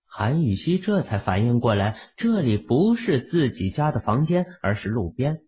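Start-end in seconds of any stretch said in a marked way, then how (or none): none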